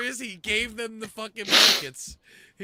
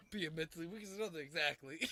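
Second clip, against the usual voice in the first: much softer, fast; under the breath; 4 semitones lower, 15 dB quieter, faster than usual. Real, not acted. Host rereading the quote, fast and hushed. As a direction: rattle it off quietly.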